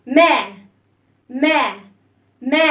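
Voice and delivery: loud, open "ما"; open vowel. Shown as a demonstration. Teacher showing children how to open the sound, open vowel. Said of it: "نفتح الصوت".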